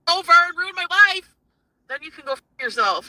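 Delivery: loud, high-pitched accusation; angry outburst. Real, not acted. Woman accusing partner of ruining her life, angry. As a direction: raise the voice in real anger.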